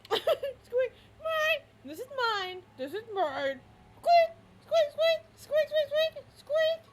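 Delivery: very high squeak imitation; high toy squeak sound; squeaky toy. Shown as a demonstration.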